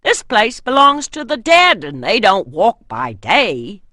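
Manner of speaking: loud, scoffing warrior; second warrior. A narrator's reading. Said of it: "a second man answered".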